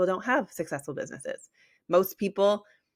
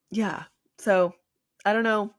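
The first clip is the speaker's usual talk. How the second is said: hiccuping; 5 semitones higher, 4 dB louder than usual. Real, not acted. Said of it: "Sorry, I'm, like, hiccuping".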